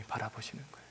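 whispered, almost no voice; hushed tender whisper. Acted. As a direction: breathe it out gently. Tender whisper at sentence end. (속삭이듯 부드럽게)

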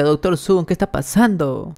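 alarma leve, tono algo subido sin gritar; grito narrado. A narrator's reading. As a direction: pregunta alarmada a media voz. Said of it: "Yuki grita". (grito contenido de narrador)

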